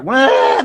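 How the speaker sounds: high-pitched fake crying "wah"; mock child's wail. Shown as a demonstration. Showing how to answer wailing kids, mock wail. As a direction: imitate a wailing child right back. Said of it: "Just imitate them".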